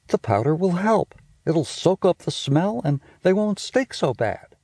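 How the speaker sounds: high, quick bossy big-sister voice; a know-it-all sister teasing her brother; Judy's voice. Acted. Big sister scolding little brother about smell, high and bossy. Judy speaking in story.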